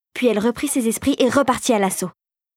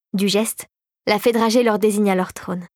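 ordinary delivery, nothing marked

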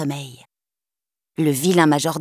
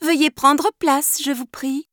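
formal invitation; 7 semitones higher than usual. Acted. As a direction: courtly welcome. Opening character line.

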